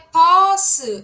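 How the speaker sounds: "posse" loud, starting on P, vowel held long; emphatic P demonstration. Shown as a demonstration. Teacher contrasts P at word start with B, loud demonstration. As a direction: hit "posse" high and loud, stretched out. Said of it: "Posse é P".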